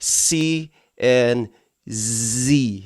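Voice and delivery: C with a long hiss, Z with a long buzz; distinction of C and Z. Shown as a demonstration. Teacher separating two letters, contrasted C and Z. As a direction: draw out C and Z so they differ. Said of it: "Dapat meron tinatawag na distinction".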